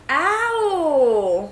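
sharp high yelp of pain. Real, not acted. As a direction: yelp from a sudden sting. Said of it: "You're being aggressive".

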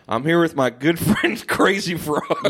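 slow, lightly imitated politician; Joe Biden. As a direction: introduce a guest as a slow mock statesman, half laughing. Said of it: "in the Oval Office with Joe Biden".

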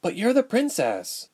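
high-pitched, puzzled protest; perplexed. A narrator's reading. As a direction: point out the obvious, baffled.

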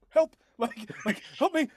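high-pitched mock plea for rescue; plea for help. Rorik looks back at companions for help, mock pleading. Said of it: "looks back at these two and is like".

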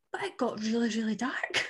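telling a friend the book got dark, whispered. Real, not acted. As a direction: lean in and whisper it. Confiding the book turns bleak.

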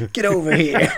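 laughing mock Scorpion yell; Scorpion shout. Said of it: "the one line where he says".

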